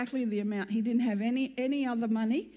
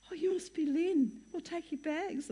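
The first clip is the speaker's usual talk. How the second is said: lightly voiced eager stranger, pitched up; chirpy greeting; 6 semitones higher than usual. Two strangers meeting pregnant newcomer at airport, lightly imitated chirpy greeting. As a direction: greet her in a higher, mock-welcoming voice.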